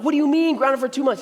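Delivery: put-on outraged child demanding answers; boy angry at his dad's punishment; indignant outrage. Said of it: "I had the audacity to be angry".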